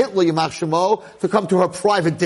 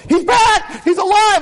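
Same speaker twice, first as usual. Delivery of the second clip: high, loud cry of relief; joyful shout; 4 dB louder, slower than usual. Retold or imitated. Townspeople hearing the child lives, joyful shouting. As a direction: shout it like the news just broke. Imagined town hearing news.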